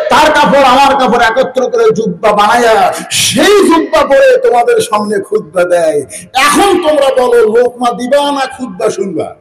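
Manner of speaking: high, ringing chanted challenge to the crowd; impassioned preaching. Acted. (উঁচু সুরে আবেগী ওয়াজ)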